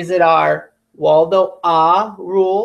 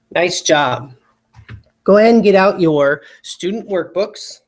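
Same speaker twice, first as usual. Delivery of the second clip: normal talking voice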